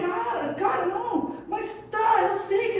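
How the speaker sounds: high-pitched pleading, one cry on the second cara; desperate protest, up high. Retold or imitated. Protesting to God in retelling.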